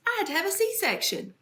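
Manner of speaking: usual speaking voice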